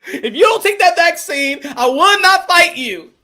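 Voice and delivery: high, loud, indignant mock fighter; mocking Charlo impression. Mocking the fighter who demands vaccines, high loud indignation. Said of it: "And people be like".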